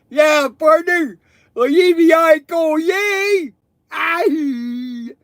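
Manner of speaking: gummy voice with teeth out; toothless. Pretend. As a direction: talk with no teeth in. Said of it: "I could take my teeth out and go like this".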